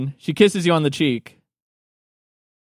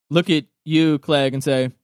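usual speaking voice

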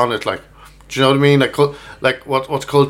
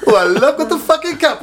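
put-on high English accent sliding into Indian; badly faked English, drifting a bit Indian; botched English accent; 10 semitones higher than usual. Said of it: "went a bit Indian there".

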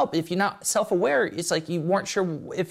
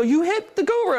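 higher, louder indignant guru cry; hammed-up outrage at a slapped guru; mock offended guru; 10 semitones higher, 4 dB louder than usual. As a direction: play the shocked disciple scolding. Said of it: "and they go".

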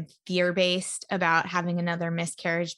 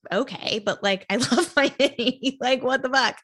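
laughing protest; 4 semitones higher than usual. Retold or imitated. To husband who wants to sell her Mini, laughing protest. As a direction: laugh it off in mock outrage.

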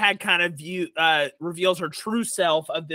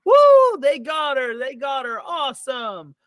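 high whooping cheer, easing off in volume; cheering crowd; 7 semitones higher, 6 dB louder than usual. Acted. Party guests celebrating the hag being led out, cheering. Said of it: "as everyone is clapping and cheering".

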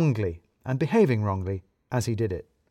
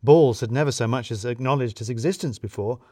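plain reading voice

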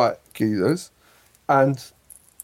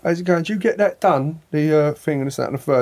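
higher, chirpy London geezer greeting; matey Cockney geezer small talk; geezer London accent; 4 semitones higher, faster than usual. Retold or imitated. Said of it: "it's accent straight away".

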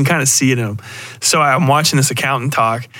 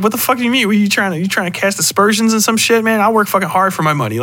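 much higher, indignant mock outrage; offended hillbilly; 7 semitones higher, faster than usual. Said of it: "somebody being like".

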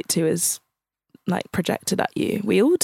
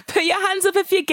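MC crowd call; 11 semitones higher than usual. Retold or imitated. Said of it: "I said".

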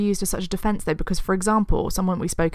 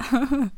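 evil laugh; 7 semitones higher, 11 dB louder than usual. Pretend. Echoes the villain laugh.

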